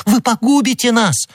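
high, frantic plea; alarmed cry. A narrator's reading. Madame Bonacieux cries out as d'Artagnan draws his sword. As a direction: cry out to stop him. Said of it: "вскричала госпожа Бонасье".

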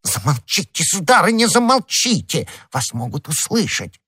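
high, alarmed pleading; frantic, stretched second plea; panicked hushing. Acted. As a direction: beg her to stop, pitch leaping up. Husband hushes wife in fear.

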